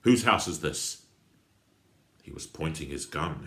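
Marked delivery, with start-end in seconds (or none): 0.0-1.1 loud, demanding question; aggressive question. Acted. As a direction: push it at him aggressively. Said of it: "George asked aggressively".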